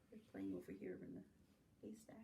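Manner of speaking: soft, fast hush dropping to a whisper. Acted. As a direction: share a secret about the mice. Reader hushes about the mice.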